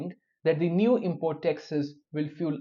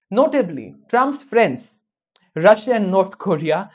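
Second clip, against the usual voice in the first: pointed sarcastic emphasis; 3 semitones higher, 8 dB louder than usual. Real, not acted. Sarcastic jab at Trump's allies.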